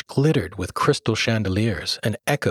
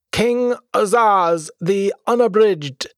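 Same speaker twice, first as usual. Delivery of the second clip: much higher, louder, slow herald call; grand announcement; 11 semitones higher, 5 dB louder, slower than usual. A narrator's reading. Page announcing the king to the startled guests, slow and grand. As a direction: proclaim the king's arrival to the hall. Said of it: "a page announced to the startled guests".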